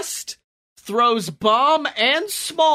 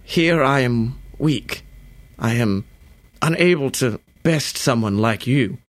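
lightly voiced frail old man, lower; 6 semitones lower than usual. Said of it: "He's a frail old man".

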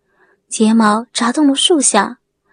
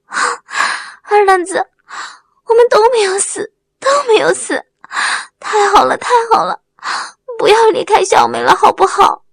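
high, breath-catching joyful cries; overwrought crying out; 8 semitones higher, faster than usual. A narrator's reading. Wang Mei calls out to Erlengzi, incoherent with joy. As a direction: gasp and babble with relief, pitched high. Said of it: "有点语无伦次地呼叫着".

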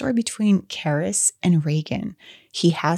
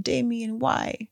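a high, soft mock plea; lightly voiced mother begging her son; pleading demon as mother; 6 semitones higher, 5 dB quieter, slower than usual. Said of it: "tried to plead to his humanity".

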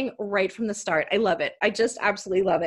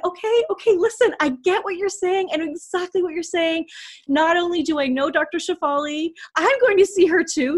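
high, bubbly imitation of an eager doctor; an excited doctor finding common ground, high and eager; excited retelling; 8 semitones higher than usual. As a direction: quote her as if bursting to share.

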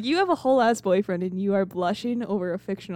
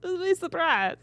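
laughing through the words, higher; 8 semitones higher than usual. Pretend. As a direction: giggle while repeating it.

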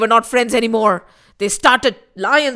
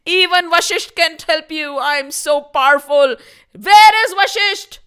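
boasting villain; 4 semitones higher, 6 dB louder than usual. Acted.

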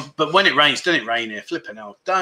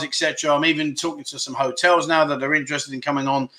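usual speaking voice

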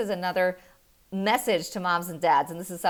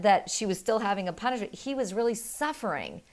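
ordinary talk, nothing marked

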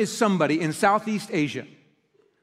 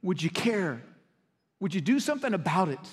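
softer, gentle searching question; God pleading softly; 7 dB quieter than usual. Retold or imitated. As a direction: ask it quietly, as if from afar. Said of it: "And God is saying".

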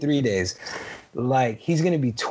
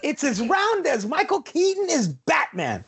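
emphatic high; 9 semitones higher than usual. Real, not acted. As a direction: voice jumps up, emphatic.

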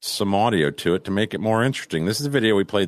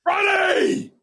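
high shouted Friday. Real, not acted. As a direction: yell it back gleefully. Echoing sign-off shouted.

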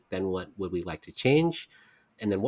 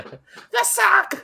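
high squealing shout of the sock; high mocking squeal; squeaky sock shout; 4 dB louder than usual. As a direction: shriek it like a cartoon wrestler. Imitating Mankind's squeal.

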